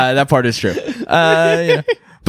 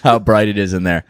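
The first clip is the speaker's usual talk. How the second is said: normal talking voice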